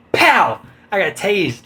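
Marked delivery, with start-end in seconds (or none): 0.1-0.6 sharp spoken taser pow; punchy pow sound effect; loud pow sound effect. Retold or imitated. Imitated taser hit.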